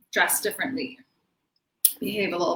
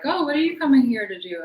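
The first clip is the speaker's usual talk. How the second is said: higher, lightly voiced small talk; imitated curious stranger; 6 semitones higher, 4 dB louder than usual. Fellow passenger asking about her trip, higher and curious. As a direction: play a chatty stranger's question, pitched up.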